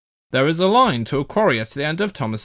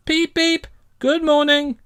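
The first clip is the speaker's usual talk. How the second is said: high friendly engine voice; cheerful whistle greeting; 4 dB louder than usual. A narrator's reading. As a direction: call out a bright hello. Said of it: "Thomas whistled".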